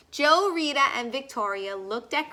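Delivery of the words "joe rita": ordinary delivery, nothing marked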